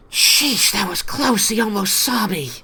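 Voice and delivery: a tiny critter sighing with relief at a near miss; high-pitched little creature, relieved; small creature voice. Acted. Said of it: "you hear".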